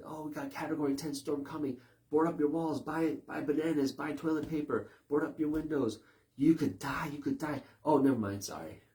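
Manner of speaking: hammed-up doom forecaster who backs off at the end; mock storm alarmist. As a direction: sell the panic, then deflate it. Imitates alarmist storm warnings.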